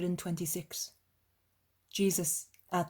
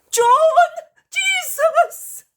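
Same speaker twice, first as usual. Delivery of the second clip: very high, loud distant call; 19 dB louder, slower than usual. A narrator's reading. Mother calling her son across the crowd, high loud shout. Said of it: "from the other end is calling him".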